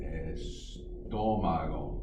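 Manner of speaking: Estómago drawn out very slowly, each syllable clear; slow modelling. Shown as a demonstration. Spanish teacher showing the class the word, very slowly. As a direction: stretch Estómago out for students to repeat. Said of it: "And there's an accent".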